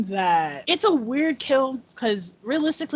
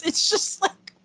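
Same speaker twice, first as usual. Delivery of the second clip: laughing; 6 semitones higher, slower than usual. Real, not acted. Laughing at the quote.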